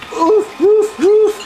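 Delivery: dog bark imitation. Person playing the dog to tease the cat, repeated high barks. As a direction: run of high, punchy woofs.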